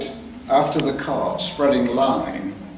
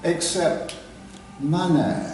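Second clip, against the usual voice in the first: condescending; 6 semitones higher than usual. Retold or imitated. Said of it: "carefully enunciated with subtle sarcasm".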